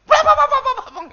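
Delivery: high, loud mock panic with a laugh; imitating scared passenger. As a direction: ham up a scared passenger on a bumpy plane. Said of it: "yang orang Kristen".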